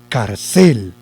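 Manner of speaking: "Carcel" mis-stressed as car-CÉL; light car, heavy long CÉL; stress on last syllable. Shown as a demonstration. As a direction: lean hard on the final CEL of "Carcel". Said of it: "si lo llevara en cel".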